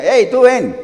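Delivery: calling out. Acted. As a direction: hail a bystander with a mild shout. Soldiers summon Simón from crowd.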